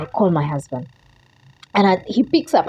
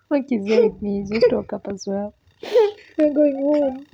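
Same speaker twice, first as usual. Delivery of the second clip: tearful joy; 8 semitones higher than usual. Real, not acted. Telling son while crying happily.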